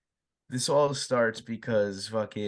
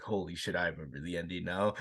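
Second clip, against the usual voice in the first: hushed realization; 6 semitones lower, 7 dB quieter than usual. Retold or imitated. Remembers ending while watching.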